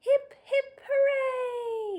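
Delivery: very high, loud group cheer; cheering yell. Acted. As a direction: cheer like a crowd of monsters. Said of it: "yelled the monsters".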